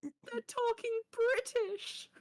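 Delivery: very high, slow fangirl squeal; Sweet. Retold or imitated. Friend thrilled by British banter, imitated squeal. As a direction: stretch it out in a high mock swoon. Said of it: "She goes".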